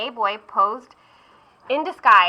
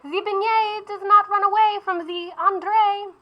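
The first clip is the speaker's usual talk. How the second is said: much higher, put-on French painter voice; indignant artist; 11 semitones higher than usual. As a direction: huff it like an offended artist.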